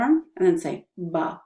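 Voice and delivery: "ba" slow, lips tucked and pressed together; "ba" held back, not spat out; slow soft B. Shown as a demonstration. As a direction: tuck your lips and say "ba" with no air. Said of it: "put your lips, tuck them in".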